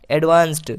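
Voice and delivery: एडवांस्ड ending in "st"; final D as T. Shown as a demonstration. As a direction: say the last letter of एडवांस्ड as ट. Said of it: "हम डी को ट पढ़ रहे हैं". (एडवांस्ड के अंत में ड की जगह ट)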